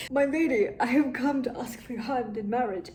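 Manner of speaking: mock gallant suitor. Mock suitor after makeup.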